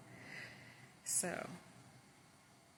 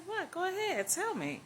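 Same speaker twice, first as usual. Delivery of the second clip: eager urging; 10 semitones higher than usual. Retold or imitated. She urges him to speak.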